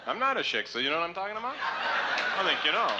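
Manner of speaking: put-on high knowing Yiddish accent. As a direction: wink at the crowd in accent. Yiddish character to audience.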